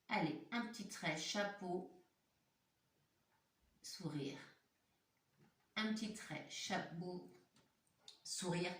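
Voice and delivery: softly guiding the little ones through the letter; soft step-by-step guide. Shown as a demonstration. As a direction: gently talk the child through each stroke. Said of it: "stroke-by-stroke letter guide".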